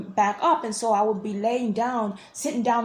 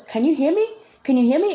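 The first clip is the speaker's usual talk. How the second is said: higher, louder questioning call with rising ends; imitated calling voice; 6 semitones higher, 5 dB louder, faster than usual. Imitating the voice she hears in her ear, calling out. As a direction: call out, lifting the end of each question. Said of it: "start hearing her voice and she goes".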